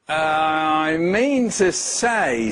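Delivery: very slow, weighty words; deliberate. Acted. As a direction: say it slowly like a spell. Nate casts his opposite trick.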